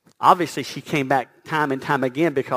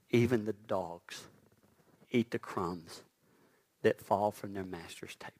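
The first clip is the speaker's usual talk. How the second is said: falling to a breathy whisper; preacher whispering the woman's plea; hushed whisper; 5 semitones lower, 7 dB quieter than usual. Acted. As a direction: draw them in, whisper it.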